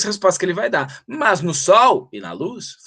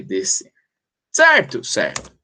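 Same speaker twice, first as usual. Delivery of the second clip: ordinary talk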